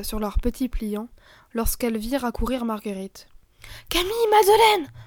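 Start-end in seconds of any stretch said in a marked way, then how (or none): 3.9-4.9 high, carrying call across the garden. Acted. Marguerite calling her friends over, a high call. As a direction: call the girls from afar. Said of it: "leur cria-t-elle".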